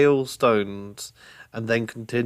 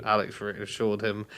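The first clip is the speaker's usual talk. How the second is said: usual speaking voice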